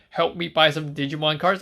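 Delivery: ordinary talk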